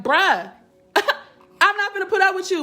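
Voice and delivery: a playful hint of her petty shadow side; fed-up shadow side. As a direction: play her fed-up inner voice. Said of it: "the shadow side be like".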